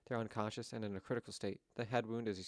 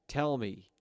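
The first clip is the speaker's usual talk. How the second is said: sudden loud, higher shout of tell; loud demand; 3 semitones higher, 8 dB louder than usual. Acted. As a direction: bark tell hard, snapping at them. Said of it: "So tell me".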